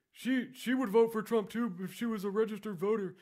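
high, goofy, dim-witted voice; dopey guy voice. Retold or imitated. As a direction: play the clueless trainee rambling happily. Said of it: "really dopey".

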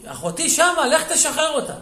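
high, loud retold plea; urgent demand. Wife urges husband to free her sister, loud and urgent. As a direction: cry out an order to go at once.

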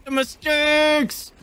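high, drawn-out shriek. Real, not acted. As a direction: wail it in mock despair. Shrieking while dragged out.

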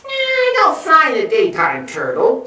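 very high, loud squeaky bat; high-pitched cartoon bat; squeaking bat. A narrator's reading. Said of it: "squeaked Bat".

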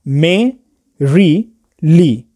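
मे, री, ली each said apart with a pause between; syllable by syllable. Shown as a demonstration. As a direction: break मैरिली into मे - री - ली, stress on मे. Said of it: "मैरिली में तीन syllable".

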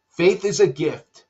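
ordinary delivery

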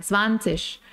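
"zwanzig" ending in sh, tsvan-tsish. Shown as a demonstration. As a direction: finish "zwanzig" with a sh sound. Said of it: "el sonido sh puede existir también".

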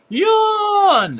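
long, high, loud yawning cry; exaggerated yawn. Shown as a demonstration. Gamer showing viewers the sudden yawns from medication, loud yawn. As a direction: stretch out a big noisy yawn. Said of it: "every time I yawn".

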